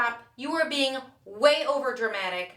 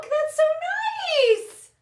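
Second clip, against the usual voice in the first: tearful; 12 semitones higher, 8 dB louder than usual. Acted. Mother touched, voice cracks.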